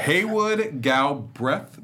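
Haywood Galbraith said slowly with a rising tone; unsure pronunciation. Real, not acted. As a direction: stretch Haywood Galbraith out, asking. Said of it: "if I'm pronouncing that correctly".

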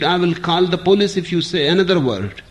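woman threatens to call police; shrieked threat. Acted. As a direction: threaten him shrilly and quickly. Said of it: "the woman shrieked".

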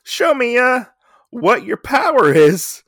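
high smug put-on voice; smug dare. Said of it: "And he's like".

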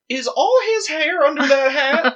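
high-pitched imitation of disbelief; mock astonishment. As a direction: quote a baffled friend, voice way up. Retells friend's shock at vanishing hair.

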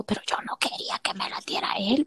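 hushed confession under the breath; whispered aside. Real, not acted. Secret aside to friends, whispered. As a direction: whisper it so he won't hear.

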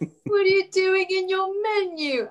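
very high, slow mock British accent; teasing, squeaky take on a British accent; imitated British accent. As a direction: mimic a co-host's British accent, stretched out. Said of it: "That's my accent. Are you taking the mick out of me?".

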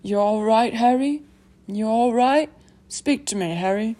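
anxious big man, lifted and urgent; concerned, rising calls; Hagrid. Acted. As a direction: check on a hurt friend, voice rising on the questions. Voices Hagrid in the story.

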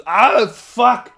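loud, high exasperated cry; comic yell of exasperation; mock frustrated outburst. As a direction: blow up in acted frustration. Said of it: "you get really frustrated".

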